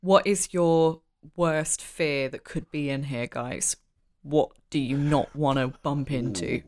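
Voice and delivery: quiet eerie. Acted. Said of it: "this is just out of character".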